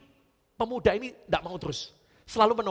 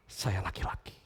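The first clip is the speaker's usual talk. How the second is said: lower, breathless whispered repeat; 10 semitones lower, 6 dB quieter than usual. Acted. The boy repeats his new truth to himself in a whisper.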